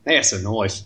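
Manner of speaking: broad put-on Australian accent; hammed-up Australian bushman; Crocodile Dundee. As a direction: quote it in thick movie Aussie. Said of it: "That's good old Crocodile Dundee".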